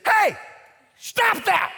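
sudden high, very loud shout; retold sharp rebuke. Dad stopping his mother on phone, shouted rebuke. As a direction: cut her off with a burst of command. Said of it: "Just like that".

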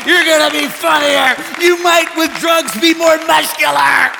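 loud, high, impassioned preacher; rally the congregation, shouting hope; full-force preaching. Real, not acted.

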